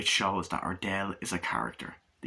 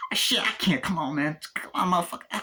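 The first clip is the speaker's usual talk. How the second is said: actor impression; 8 semitones higher than usual. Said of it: "doing the impressions of the, uh, actors".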